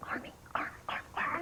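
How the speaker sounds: urgent stage whisper; feed the next word in a whisper; whispered prompt. Acted. Prompting the boy during his phone call, whispered.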